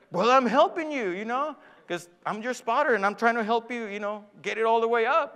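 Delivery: retold defensive dad. Dad explaining himself to his angry son, defensive. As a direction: protest that you meant to help.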